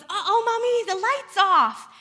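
put-on high, loud toddler voice with drawn-out words; acted toddler alarm; concerned little boy. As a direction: imitate a worried two-year-old calling for mom. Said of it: "he's all concerned".

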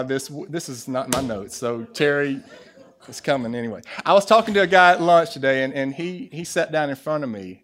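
usual speaking voice

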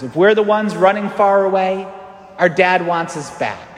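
normal talking voice

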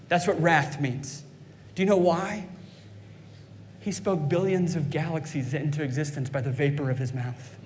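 usual speaking voice

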